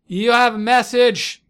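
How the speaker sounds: high, slow call from the desk; innkeeper voice. Acted. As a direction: announce it slow and loud like an innkeeper. Innkeeper calls Nigel.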